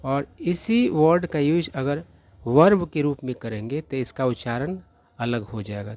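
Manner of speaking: ordinary talk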